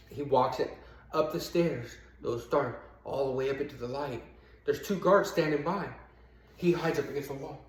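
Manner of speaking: ordinary delivery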